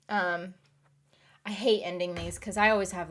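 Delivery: ordinary talk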